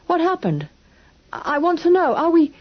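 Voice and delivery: high, stammering worry; frightened child pleading; anxious boy. A narrator's reading. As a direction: push the questions out nervously.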